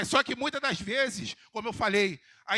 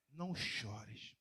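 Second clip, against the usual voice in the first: hushed comfort; 11 semitones lower, 16 dB quieter, much slower than usual. Acted. Jesus comforting the widow, hushed.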